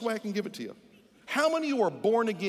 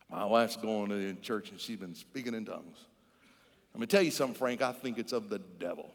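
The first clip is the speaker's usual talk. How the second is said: Joe confiding; 11 semitones lower, 4 dB quieter than usual. Acted. Husband in a bar telling his buddy about his wife, low and confiding. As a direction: drop the voice low and confide gravely.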